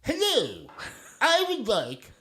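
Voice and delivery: high, slow, drawn-out silly voice; silly high-pitched ordering voice; silly put-on voice. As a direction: order food in a high, goofy, stretched-out voice. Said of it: "could not stop doing the voice".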